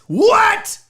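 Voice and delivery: high, loud shocked "What?"; mock surprise. Host mock-shocked at admitting pizza is perfect. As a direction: yelp it in fake shock.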